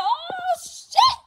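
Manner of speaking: high, loud, fast cry of joy; excited shout. Retold or imitated. Grandma shouting joy at the new baby. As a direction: shout the news. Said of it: "I said".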